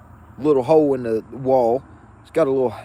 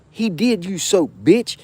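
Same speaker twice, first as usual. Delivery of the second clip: retold yell; 5 semitones higher than usual. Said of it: "Yelled that through my door".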